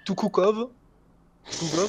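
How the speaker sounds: "Tukukov" tried out with a questioning rise; prononciation laborieuse. Real, not acted. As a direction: attempt the name "Tukukov" unsurely, ending upward. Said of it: "la prononciation, elle va faire mal". (« Tukukov » tenté avec une intonation montante)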